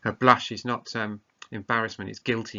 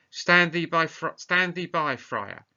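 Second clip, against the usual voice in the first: commanding; 6 semitones higher, 5 dB louder, slower than usual. Acted. Claudio halting the wedding.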